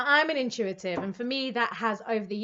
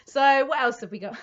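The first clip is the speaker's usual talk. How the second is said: normal talking voice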